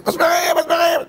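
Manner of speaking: sudden high alarmed cry; high startled outcry; crying out nails. Retold or imitated. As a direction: yell it out as if pricked by nails. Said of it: "הוא היה צועק".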